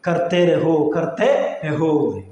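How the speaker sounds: "കർത്തേരഹു" drawn out, syllable by syllable; slow, separated "കർത്തേരഹു"; slow teaching demo. Shown as a demonstration. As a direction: stretch "കർത്തേരഹു" slowly for learners. Teacher stretches phrase for learners.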